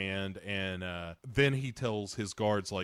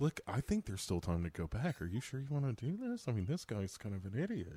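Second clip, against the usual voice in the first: softer, hesitant undertone; doubtful aside; 2 semitones lower, 7 dB quieter than usual. Acted. Follower doubting the hero's plan, hushed doubt. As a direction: quietly try to talk them out of it.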